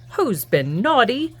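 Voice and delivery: ordinary delivery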